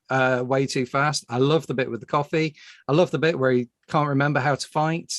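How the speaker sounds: ordinary talk, nothing marked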